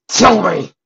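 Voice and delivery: high, very loud demand; furious shout. A narrator's reading. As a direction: explode into a yelled order. Furious demand while grabbing his collar.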